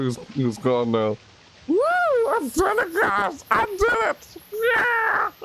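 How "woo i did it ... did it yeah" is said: ecstatic celebrating. Real, not acted. Celebrating escape.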